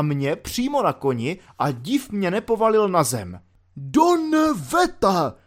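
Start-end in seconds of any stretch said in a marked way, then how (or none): 3.8-5.3 high, loud bellowed curse; roaring officer. Retold or imitated. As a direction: roar it like a furious colonel across the parade ground. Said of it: "zařval". (zařvat jako rozzuřený obrst)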